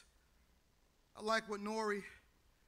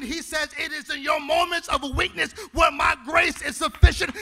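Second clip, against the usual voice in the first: loud, higher, half-sung whoop; preaching at full force; 7 semitones higher, 21 dB louder than usual. Real, not acted. Pastor to grieving congregation, full whooping climax. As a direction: shout it to the rafters.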